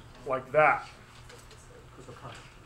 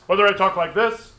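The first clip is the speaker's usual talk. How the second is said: much louder, same pitch; raised demo voice for the class; loud talk; 4 semitones higher, 10 dB louder than usual. Shown as a demonstration. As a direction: boom it out to show more volume. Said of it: "you can make a sound louder".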